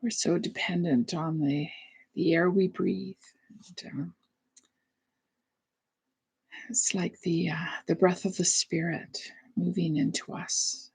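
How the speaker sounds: soft, spacious breathing cues with long pauses; hushed meditation guide; guided meditation. Real, not acted. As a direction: lead the breath gently, letting the voice fade.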